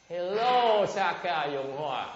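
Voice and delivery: loud, high mock hello; rude greeting. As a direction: parody a too-casual greeting. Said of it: "look how it started". (chào hỏi suồng sã kiểu chế giễu)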